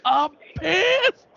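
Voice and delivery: high mock-angry whine; lightly hammed fed-up impression; imitating Liz. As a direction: redo last week's bit of her outburst. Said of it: "I'm trying to do what I did last week".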